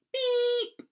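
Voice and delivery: long, loud, high-pitched beep; horn beep. Pretend. Reading the truck story to children, imitated horn beep. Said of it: "make a beep sound".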